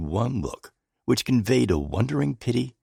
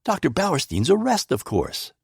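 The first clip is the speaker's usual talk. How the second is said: impatient; 9 semitones higher than usual. A narrator's reading. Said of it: "I answered impatiently".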